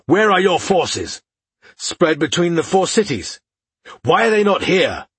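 angry demands, pitched high and drawn out; spitting anger. A narrator's reading. Aladir demands of his brother where the forces are, angry. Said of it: "Aladir spat".

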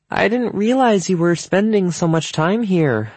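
high, strained-polite voice; tight-smiled politeness. A narrator's reading. Mom confronting Evan in front of hosts, tightly polite. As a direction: squeeze it through a tight smile. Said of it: "barely squeeze the words through her tight smile".

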